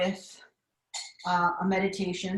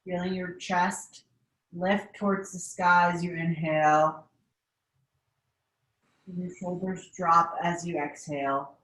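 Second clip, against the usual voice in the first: guided meditation. Acted. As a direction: guide the class through breathing, unhurried. Leads a seated meditation.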